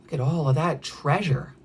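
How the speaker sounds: plain reading voice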